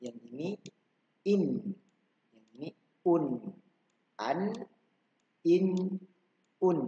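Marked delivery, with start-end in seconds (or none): 4.2-6.8 "An, in, un" each vowel closed with n, higher; tanwin an in un. Shown as a demonstration. Teacher drills an, in, un for the children. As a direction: call out the three endings clearly. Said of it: "Ini sama juga kayak tadi".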